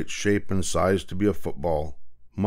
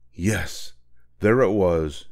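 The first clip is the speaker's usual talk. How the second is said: usual speaking voice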